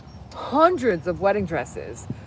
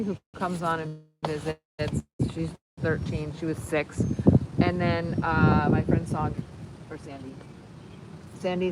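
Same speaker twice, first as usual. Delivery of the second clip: ordinary talk, nothing marked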